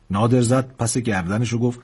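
ordinary delivery

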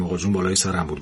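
ordinary delivery

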